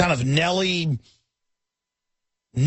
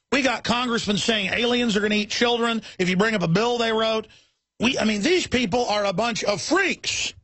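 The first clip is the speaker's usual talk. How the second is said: usual speaking voice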